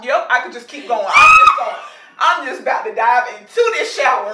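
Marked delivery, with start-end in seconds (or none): 1.1-1.8 higher and louder hammed-up wail; playful laughing yell; laughing shout. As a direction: belt it out as a mock meltdown. Hammed-up shower crying.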